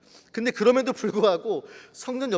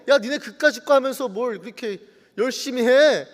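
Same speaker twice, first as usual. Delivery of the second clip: jeering, raised-voice taunt; mocking; 4 semitones higher, 5 dB louder than usual. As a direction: scoff at their pointless effort. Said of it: "조롱해요". (비웃듯 조롱하는 말투)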